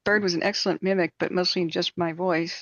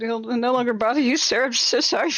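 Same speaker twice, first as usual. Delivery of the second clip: higher, apology through giggles; laughing apology; 5 semitones higher, 4 dB louder than usual. Retold or imitated. Apologizing to painter.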